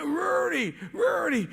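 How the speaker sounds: name called out loud and high in delight; excited shout of a name. Retold or imitated. The man beside him hugging him and cheering his name, excited shout. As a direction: cry his name out with joy. The guy next to me starts hugging me.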